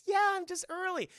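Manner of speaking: high-pitched, over-casual mom voice; mock innocent mom. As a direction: play the mom brushing off the question. Mom covers for waiting outside.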